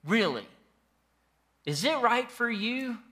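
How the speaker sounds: high, disbelieving voice of God; God asking in exasperated disbelief; God asking Jonah in high mock disbelief; incredulous God. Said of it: "God is like".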